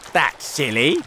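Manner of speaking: loud, high dismissal; scornful cry. A narrator's reading. Earthworm rejecting James's plan, scornful cry. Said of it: "cried the earthworm".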